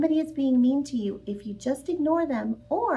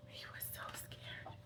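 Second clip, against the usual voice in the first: breathless frightened whisper; scared whisper; 30 dB quieter than usual. A narrator's reading. Reader whispering the scared rodent's fear. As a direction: hush it like a scared child. Scary teacher scene read aloud.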